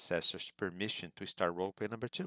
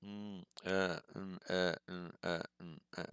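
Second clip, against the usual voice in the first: repeated "mm, uh" filler noises, fading off; demonstrated hesitation sounds. Shown as a demonstration. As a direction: mimic a stalled speaker's hums and uhs. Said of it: "making tangent sounds".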